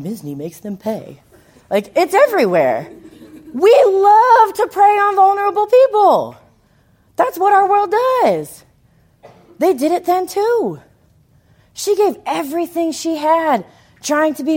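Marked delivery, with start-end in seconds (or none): 0.0-1.2 mock lawyer ad. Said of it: "billboard from a lawyer that says". 3.5-12.9 much higher and louder, driving the point; preaching at full force. Real, not acted. Preacher to congregation about preying on the vulnerable, loud and high. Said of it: "preacher rising in intensity".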